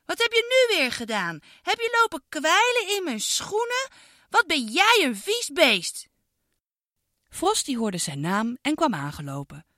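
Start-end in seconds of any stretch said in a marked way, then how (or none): 0.0-6.1 put-on high-pitched girl scolding, slowing at the end; angry scolding. Girl scolds the dog for drooling, high and cross. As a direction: tell off a naughty dog, disgusted. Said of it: "riep Iris boos".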